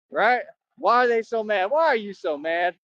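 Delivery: ordinary delivery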